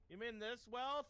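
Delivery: high, loud taunting question; mocking taunt. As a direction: sneer it at the spirit. Taunting the spirit, in character.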